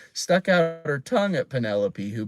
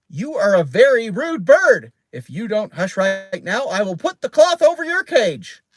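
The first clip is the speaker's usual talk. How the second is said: scolding; 8 semitones higher, 7 dB louder than usual. A narrator's reading. Child scolding a rude parrot.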